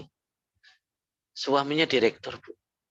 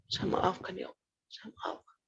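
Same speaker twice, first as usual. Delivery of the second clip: higher, tearful whisper fading out; crying forgiveness; 8 semitones higher, 9 dB quieter than usual. Retold or imitated. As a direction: plead through tears, almost silent. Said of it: "Sambil nangis dia".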